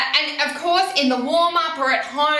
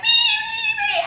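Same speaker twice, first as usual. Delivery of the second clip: squeaky, much higher, delighted wee wee; gleeful horse squeal; 5 dB louder, slower than usual. Pretend. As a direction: voice the happy horse squealing with glee. Horse feels like it's dancing.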